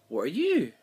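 mock-eager barrage of questions; eager questioning. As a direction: pepper them with wide-eyed questions. Clara quizzing everyone she meets.

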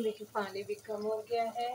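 ordinary talk

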